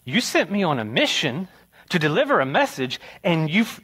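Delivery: put-on a little boy pleading, climbing to a squeal; wailing child. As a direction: youngest son protesting to Dad. Retelling being left behind, wailing.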